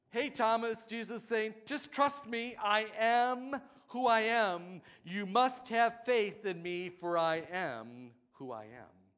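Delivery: urgent plea. Retold or imitated. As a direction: urge him with force.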